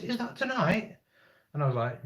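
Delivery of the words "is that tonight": higher, a mock-eager question; imitating the copper perking up at the meteor news; surprised policeman. Camper retells how the policeman suddenly perked up, lightly surprised. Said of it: "And he goes".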